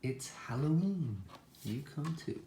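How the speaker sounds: storybook character calling others over; little truck's invitation. A narrator's reading. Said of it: "says Little Blue".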